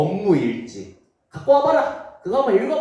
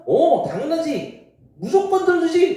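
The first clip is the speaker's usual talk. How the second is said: higher, louder hearty assurance; eager king; 13 semitones higher, 4 dB louder than usual. Acted. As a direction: reassure the queen without hesitation. (높고 크게 흔쾌히 답하는 왕)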